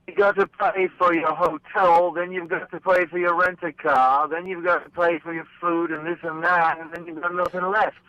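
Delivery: a lightly put-on English accent, played for laughs. Joking with the host about road costs, in a light mock English accent. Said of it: "if we're gonna do an English accent".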